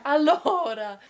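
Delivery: imitating an Italian "Allora". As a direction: echo "Allora" up high like the host. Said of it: "she kept on saying".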